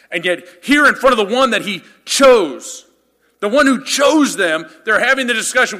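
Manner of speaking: loud, high, driving sermon voice; full-force preaching. Acted. Pastor driving home the disciples' pride, loud preaching. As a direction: preach it to the back row.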